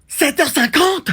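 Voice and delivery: high, drawn-out disbelief; alarmed surprise. Acted. Late sleeper discovering the time, alarmed. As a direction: read the time aloud in high-pitched alarm.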